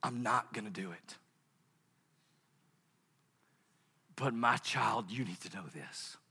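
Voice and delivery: low, hushed, slowing to near whisper; tender voice of God. Acted. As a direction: speak lovingly to your child, unhurried.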